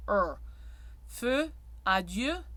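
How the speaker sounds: feu and adieu with a rounded mixed vowel; closed O slash. Shown as a demonstration. Coach demonstrating the closed O slash to singers. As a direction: adieu said slowly. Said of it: "close to an er sound".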